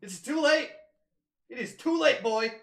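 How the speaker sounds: hammed-up gloating; mock triumphant yell. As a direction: crow over the friend like a cartoon villain. Mock gloating over chests.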